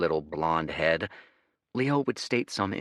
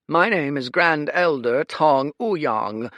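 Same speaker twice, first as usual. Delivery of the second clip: pompous elder; 9 semitones higher, 8 dB louder, slower than usual. Acted. Elder introduces himself.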